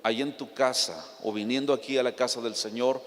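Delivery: ordinary delivery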